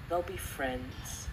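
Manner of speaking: ordinary delivery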